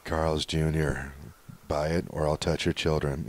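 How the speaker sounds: low, slow, creepy whisper-like voice; a creepy pitchman, low and slow; creepy commercial voice. Retold or imitated. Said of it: "the guy with the creepy voice".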